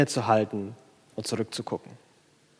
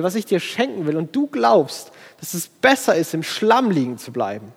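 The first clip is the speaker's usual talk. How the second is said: God pleading, higher and rising with grief; disappointed loving father voice; sad God; 7 semitones higher than usual. Acted. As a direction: voice God's sorrowful longing for someone wasting what He offers. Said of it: "Das, das macht mich traurig".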